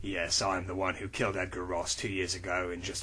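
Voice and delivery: low, soft gravel of a tough hero; low, quiet tough-guy voice; gruff hero voice. Acted. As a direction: read it like a brooding action hero. Low character lines.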